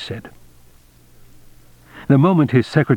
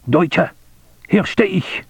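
high, strained croak; croaking half-bark, half-whisper; 8 semitones higher, slower than usual. A narrator's reading. Broken leader defying the courtroom, croaking half bark, half whisper. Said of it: "a croaking, half bark, half whisper".

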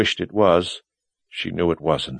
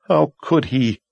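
anguished; 9 semitones higher, 3 dB louder than usual. A narrator's reading. Kaelin anguished.

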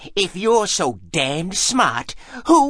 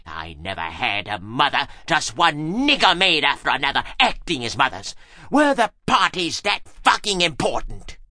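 enraged; 4 semitones higher, 3 dB quieter than usual. A narrator's reading. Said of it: "threw him into a rage".

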